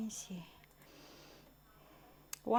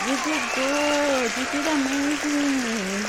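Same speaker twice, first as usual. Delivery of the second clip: excited; 6 semitones higher, 9 dB louder than usual. Real, not acted. Praising herself after the walk.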